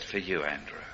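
a hushed, sorrowful mother's reply; sad mother. Retold or imitated. Preacher voicing his wife answering their son, hushed.

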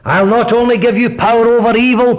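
high, rising preacher's proclamation; preaching. Acted. Preacher proclaiming God's presence to the congregation, fervent. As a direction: proclaim it to the hall with swelling fervour.

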